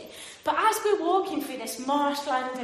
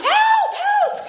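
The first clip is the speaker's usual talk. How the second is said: shrill, very high and loud shout; hammed-up distress call; mock cry for help; 9 dB louder than usual. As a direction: yell for help to an empty beach. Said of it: "she runs off".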